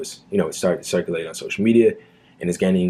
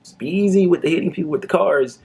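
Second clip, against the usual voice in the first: starts whispered, then higher, casual bro voice; imitating a cop; 8 semitones higher than usual. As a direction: play the cop hinting the camera is on. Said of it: "He's like".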